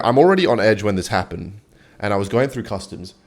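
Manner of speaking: usual speaking voice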